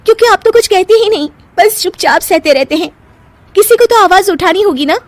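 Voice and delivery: high, heated protest; exasperated. Acted. Wife defending her quarrel to husband, exasperated and high. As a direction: argue back in exasperation.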